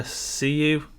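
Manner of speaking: ordinary talk, nothing marked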